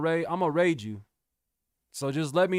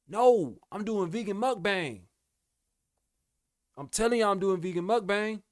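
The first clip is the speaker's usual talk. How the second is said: insistent hype; 6 semitones higher, 3 dB louder than usual. Real, not acted. Insisting loudly to chat.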